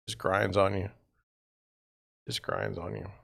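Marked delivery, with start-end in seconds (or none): 2.3-3.1 much softer, lower, tired repeat; worn-down fading mutter; quiet weary echo. Real, not acted. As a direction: let it sink under your breath.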